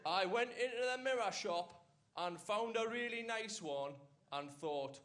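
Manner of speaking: slow, measured recital of a joke; deliberate joke delivery. Acted. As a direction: set out the joke very slowly. Recites Barry's written joke to audience.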